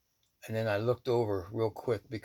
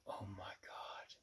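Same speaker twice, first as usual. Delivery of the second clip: hushed, barely voiced whisper; awed whisper; 16 dB quieter than usual. Woman looking over his shoulder at the sight, awed whisper. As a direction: whisper it in stunned awe.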